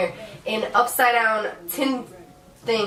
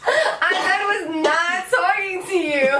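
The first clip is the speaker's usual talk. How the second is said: laughing; 8 semitones higher, much slower than usual. Retold or imitated. Acts embarrassed reply to owner.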